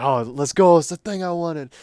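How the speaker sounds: loud delighted cheer; excited kid. Retold or imitated. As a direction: celebrate the gift like a kid. Kid thrilled at gift.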